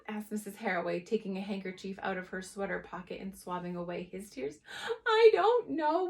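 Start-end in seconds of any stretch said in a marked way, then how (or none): 5.1-6.1 high, loud, slow wail. Acted. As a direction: whine it out tearfully. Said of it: "wailed Fetlock".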